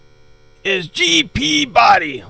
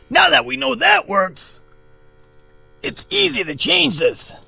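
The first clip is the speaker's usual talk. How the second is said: ordinary delivery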